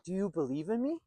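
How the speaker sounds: mock approval-seeking question, rising at the end; lightly acted needy question; validation-seeking questioner. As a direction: imitate someone checking for validation, voice lifting into the question. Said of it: "you start asking questions like".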